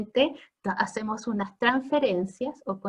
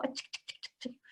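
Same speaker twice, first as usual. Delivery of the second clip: soft voiceless clicking chirps like a cat; hushed imitation of cats following you; imitated cat sounds; 16 dB quieter than usual. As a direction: tick out tiny cat noises, barely audible. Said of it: "así como".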